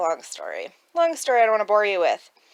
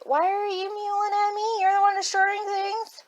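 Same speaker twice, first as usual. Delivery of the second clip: very high-pitched pet-directed voice; scolding a pet; 13 semitones higher than usual. Real, not acted. Talking to the mewling pet who destroys things, high-pitched scolding.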